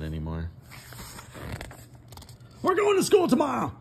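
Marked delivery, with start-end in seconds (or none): 2.6-3.7 much higher, bright announcing voice; the farmer bursting with news; excited Farmer Brown. Acted. Farmer Brown tells the cows about school, excited. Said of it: "Farmer Brown told the chickens".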